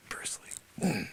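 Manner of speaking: whispered on a long sigh. Acted. Sensei remembering his star pupil in a sighing whisper. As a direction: breathe the name out wistfully. Wistful memory of student.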